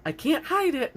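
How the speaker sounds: high, loud panicked blurt; panicked gasp. Retold or imitated. Retelling breaking the glasses, high panicked blurt. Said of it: "and I was like, gasps".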